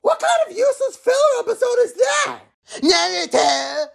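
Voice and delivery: Naruto. Acted. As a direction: yell it out, cocky and impatient. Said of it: "It is Naruto!".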